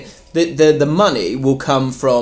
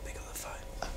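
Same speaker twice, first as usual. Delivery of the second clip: unvoiced whisper to himself; stage whisper urging; 26 dB quieter than usual. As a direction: hiss it under your breath. Whispered aside in bit.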